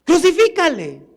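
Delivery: high cry with a falling end; imitated mob shout. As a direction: act out a crowd demanding execution. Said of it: "ahora estaban gritando".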